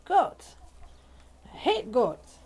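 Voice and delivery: cranky rooster voice; voicing the grumpy rooster. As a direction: sneer the word goats as the rooster. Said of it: "He's like".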